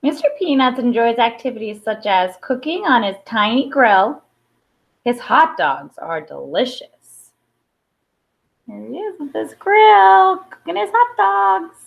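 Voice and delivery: plain reading voice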